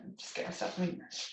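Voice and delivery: fast under-breath whisper; librarian shushing whisper. Acted. Alone after the manager quits.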